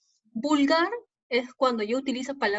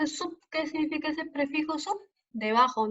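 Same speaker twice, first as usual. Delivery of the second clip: usual speaking voice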